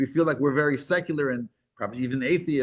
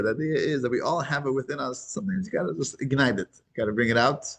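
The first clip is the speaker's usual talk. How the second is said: ordinary talk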